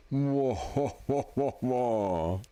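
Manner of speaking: voiced sad trombone, slow wah-wah. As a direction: mimic a falling trombone fail. Sound app trombone effect.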